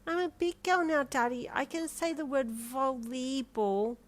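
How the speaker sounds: put-on high, slow child voice; proud little girl. A narrator's reading. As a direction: insist like a kid who is big now. Small child correcting her daddy.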